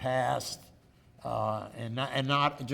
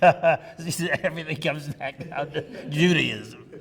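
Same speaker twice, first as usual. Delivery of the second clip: laughing; 2 semitones higher, 9 dB louder, slower than usual. Real, not acted. Joking about Judaism, laughing.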